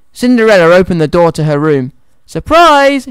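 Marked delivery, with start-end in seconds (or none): 2.3-3.1 high, bright "surprise" call; high-pitched excited exclamation; joyful shout. A narrator's reading. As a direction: burst out as if springing a surprise. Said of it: "shouted the mice and the birds".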